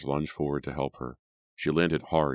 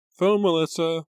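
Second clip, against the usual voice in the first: much higher, louder call from afar; 7 dB louder than usual. A narrator's reading. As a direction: shout it up the stairs. Mother calling again from downstairs.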